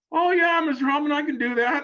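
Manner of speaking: high, bright, eager yes to the boss; eager agreement. Retold or imitated. Telling the team owner he can manage his son, eagerly high-pitched. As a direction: chirp it high like a nervous kid. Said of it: "And I said".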